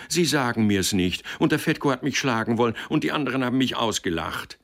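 high, loud, fast whiny rush; complaining. A narrator's reading. Son complaining to his father, fast high whine. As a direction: tumble out the grievance to father. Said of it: "klagte er".

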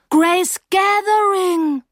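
high, loud, long-drawn amazement; amazed recognition. A narrator's reading. Narrator suddenly remembering her old friend, amazed. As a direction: stretch the name out in astonished recognition.

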